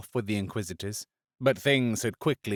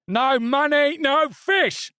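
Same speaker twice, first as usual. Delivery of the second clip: high-pitched angry bellow of a stallholder; furious market trader shouting; bellowing fishmonger; 5 dB louder, slower than usual. A narrator's reading. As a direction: roar it at the thief. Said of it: "bellowed the stallholder".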